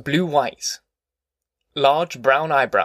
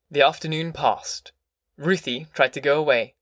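plain reading voice

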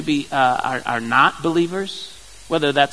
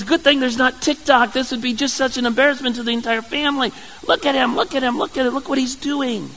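higher, scornful jeering voice; mocking Michal; 7 semitones higher than usual. Wife scoffing at her husband's dancing, high and mocking. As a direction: sneer at him like a scandalized wife. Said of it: "Michal looks at him and says".

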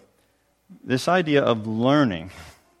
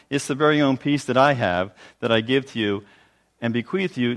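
normal talking voice

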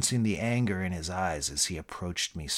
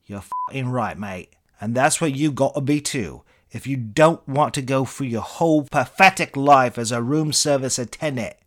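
put-on British accent, louder and contemptuous; contemptuous Eric Idle; 4 semitones higher, 8 dB louder than usual. British accent, the celebrity mocking the room service attendant. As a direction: spit out the job title in a mocking British voice. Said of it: "your British accent".

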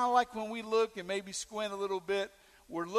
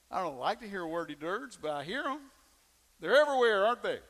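ordinary delivery

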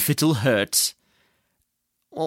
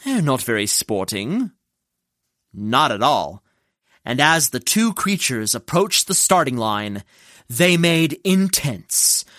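plain reading voice

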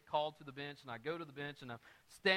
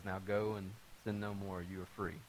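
solemn judge; 7 semitones lower, 4 dB quieter than usual. Retold or imitated. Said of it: "he says".

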